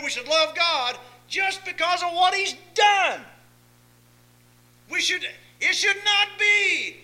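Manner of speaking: high, ringing pulpit shout; impassioned sermon crescendo, held high; full-force preaching. Real, not acted.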